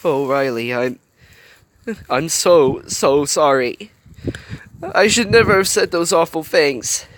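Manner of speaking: a touch of tearful pleading; near tears. Acted. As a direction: plead sorry on the verge of crying. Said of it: "about to collapse into tears".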